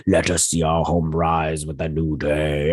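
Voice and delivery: slow mock possessed voice; imitating someone possessed, trance-like. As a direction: intone it slowly as if taken over. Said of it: "all possessed and everything".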